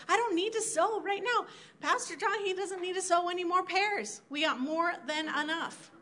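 high, soft self-excusing voice; mock complacency. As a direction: brush it off, high and light.

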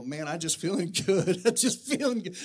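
laughing and letting it all out; bubbling over, half laughing; joyful laughing outburst. Retold or imitated. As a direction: laugh the joy right out. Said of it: "great feeling to let it all out".

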